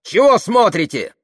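high, loud angry question; aggressive challenge. A narrator's reading. Tamara confronting the staring crowd, aggressive challenge. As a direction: shout it right at the onlookers.

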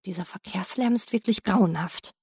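muttered under his breath; half-voiced to himself. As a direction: say it softly to yourself, annoyed. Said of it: "sagte halblaut zu sich selbst".

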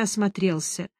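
usual speaking voice

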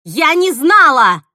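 loud, high, drawn-out shout; desperate scream. A narrator's reading. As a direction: scream it out in self-defence. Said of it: "закричала Мила". (отчаянный протяжный крик)

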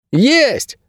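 put-on high-pitched boy's call, pitched far above narration; sharp rise into a high shout. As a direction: cry it out high, calling to someone. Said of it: "крикнул Леви".

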